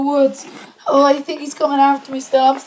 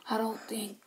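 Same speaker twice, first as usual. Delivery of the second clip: faint, breathless whisper; dying patient whisper; weak whisper; 7 semitones lower, 24 dB quieter than usual. Acted. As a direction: barely get the words out.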